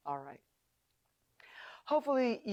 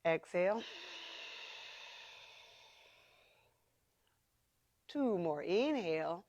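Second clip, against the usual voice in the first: Ujjayi breath; 4 dB quieter than usual. Shown as a demonstration. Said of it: "That's Ujjayi breath, ... the noisy, throated breathing".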